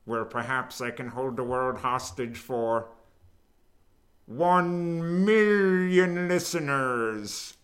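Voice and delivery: hammed-up villain making demands; drawn-out, loud mock-villain ransom voice; Doctor Evil. Host holding the world hostage, mock supervillain. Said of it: "that's a little more Doctor Evil".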